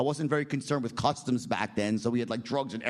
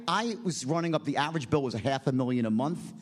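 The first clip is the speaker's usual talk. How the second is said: ordinary talk, nothing marked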